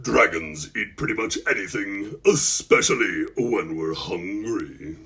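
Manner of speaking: very low, slowing dragon growl; deep dragon voice. A narrator's reading. Dragon telling Malcolm what it eats, deep dragon voice. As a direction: go deep and drag out the end. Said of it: "in its deep, deep dragon voice".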